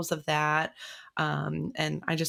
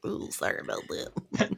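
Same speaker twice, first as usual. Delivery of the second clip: much lower, airy sheepish apology; deep voice; 9 semitones lower, 3 dB quieter than usual. Retold or imitated. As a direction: drop the voice way down, apologetic. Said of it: "both of you have deeper voices".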